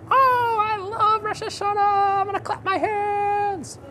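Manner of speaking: high excited puppet voice; squeaky eager kid puppet, bouncing with excitement; Nachman puppet voice. Acted. Puppet character speaks.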